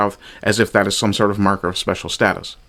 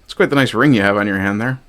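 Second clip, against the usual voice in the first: normal talking voice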